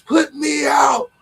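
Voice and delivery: high drawn-out mock yell; mock yelling woman. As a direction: ham up a screaming customer. Retelling woman yelling at McDonald's.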